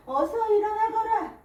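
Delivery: high, loud wake-up call; rousing call. A narrator's reading. Elders wake mother at dawn to thresh, rousing call. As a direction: call out to get a sleeper up.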